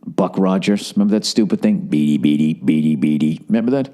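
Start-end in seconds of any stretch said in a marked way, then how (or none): 1.8-3.4 low, droning robot chirp; robot beeping. Retold or imitated. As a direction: do the little robot's beeping before it speaks. Imitates the Buck Rogers robot.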